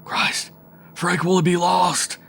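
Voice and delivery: anguished cry. A narrator's reading. Wells cries out in fog.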